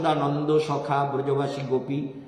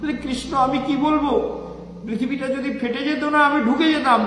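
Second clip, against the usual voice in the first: higher, louder despairing cry to Krishna; despairing lament; 7 semitones higher, 4 dB louder than usual. Acted. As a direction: plead to Krishna like there's no way out.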